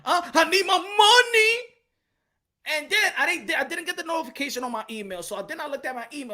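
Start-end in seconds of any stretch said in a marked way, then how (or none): 0.0-2.8 mock whine. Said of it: "I said".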